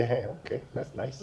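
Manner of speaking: very soft, offhand reply; retold brush-off, barely voiced; dismissive acknowledgement. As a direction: murmur it as polite dismissal. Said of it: "I just laugh. I say".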